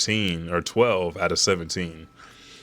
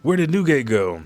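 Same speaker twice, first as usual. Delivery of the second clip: a higher mock call; Stussy calling out; 7 semitones higher than usual. Stussy calling out after Newgate, higher. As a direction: lift the voice up to call for a lost crewmate. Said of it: "Stussy says".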